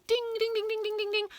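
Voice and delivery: high ringing ding-ding; bell imitation. A narrator's reading. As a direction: chime like the school bell.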